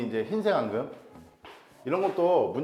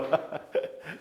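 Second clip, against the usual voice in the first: soft, breath-only chuckle; laughing; 5 dB quieter than usual. Real, not acted. Teacher amused by the student's expression, soft laughing. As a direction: let out a quiet, airy laugh.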